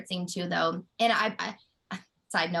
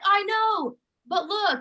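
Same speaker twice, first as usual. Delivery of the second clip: imitating costar; 11 semitones higher, 5 dB louder, slower than usual. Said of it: "He's like".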